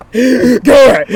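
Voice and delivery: sobbing at the family to leave, hammed-up; imitated sobbing. Imitating a wailing sob, high and loud. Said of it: "I was like".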